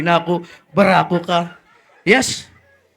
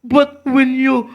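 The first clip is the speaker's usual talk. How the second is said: ordinary talk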